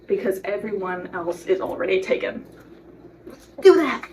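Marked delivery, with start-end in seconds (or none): 3.6-4.1 loud, high excited burst. Real, not acted. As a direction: belt it out. Emphatic encouragement.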